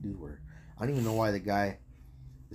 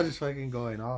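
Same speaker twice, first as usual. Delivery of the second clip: ordinary talk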